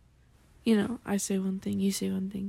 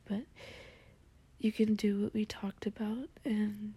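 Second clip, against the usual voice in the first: ASMR whisper. Acted. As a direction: whisper close and gentle to the listener. Soft-spoken ASMR morning roleplay.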